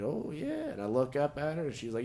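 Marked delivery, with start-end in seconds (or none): 0.0-0.7 high, soft pleased oh yeah; mock smug oh yeah. Self-satisfied quote, high soft.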